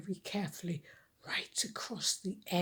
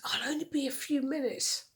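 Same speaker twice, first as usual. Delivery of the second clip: put-on pleading boy; 7 semitones higher than usual. Cliff pleads with sister.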